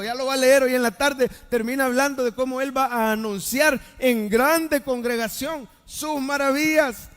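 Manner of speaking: voz alta y potente que proclama victoria; proclámalo con fuerza a toda la congregación; prédica a plena fuerza. Acted. Preaches victory at full force. (predicación triunfal y encendida)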